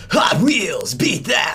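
high, loud hype-announcer shout; Hot Wheels announcer. Retold or imitated. Imitating cousin's husband's famous voice job, hyped announcer shout. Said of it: "he did the".

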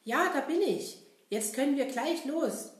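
high, cheerful puppet clown; bright, eager clown talking to kids; Kasper puppet voice. Acted. Kasper replies to Seppel.

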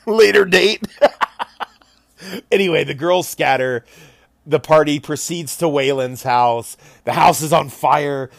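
normal talking voice

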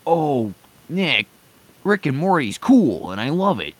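half-mocking impression of defensive fans; mock fan protest. Mimicking fans who defend the show.

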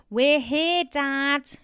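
high, loud shout to Dad. A narrator's reading. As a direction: call out loudly as if to the hard of hearing. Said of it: "shouted Stephen".

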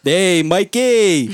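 high, loud, stretched-out holler; construction worker. Retold or imitated. Construction worker hailing his buddy at night, loud mock holler. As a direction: yell slowly for a workmate. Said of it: "hear the construction workers talk".

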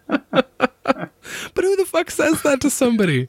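high, slow and laughing; laughing disbelief. Real, not acted. Retelling the neighbor's creepy remark, laughing in disbelief. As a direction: laugh it out, incredulous.

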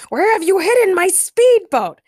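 high, loud, put-on villain voice; indignant high-pitched yell; villain demanding. A narrator's reading. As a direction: demand it in an outraged cartoon shout. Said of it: "Penguin demanded".

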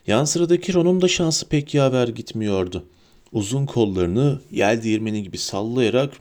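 usual speaking voice

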